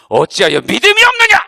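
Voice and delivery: loud, heavy fall on 없느냐; stern scolding. Retold or imitated. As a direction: scold them sharply. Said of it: "이렇게 막 혼내시더라고요". (믿음 없다고 혼내는 예수님)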